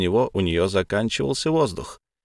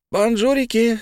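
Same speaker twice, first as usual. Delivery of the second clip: high chirpy fairy greeting; bright bubbly girl voice; pixie; 12 semitones higher than usual. A narrator's reading. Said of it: "блондинка приветливо растопырила пальцы".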